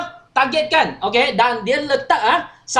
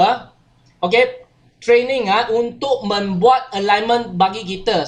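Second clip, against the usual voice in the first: normal talking voice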